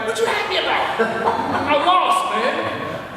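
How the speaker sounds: high-pitched, incredulous ask; mock disbelief, voice leaping up; baffled question. Lost student asking a cheering teacher why he's happy. Puzzled at teacher celebrating loss.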